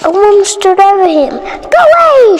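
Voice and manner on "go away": high, loud shout to go away; yelling. Acted. Cook shouting at boy on her steps, loud. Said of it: "she yelled".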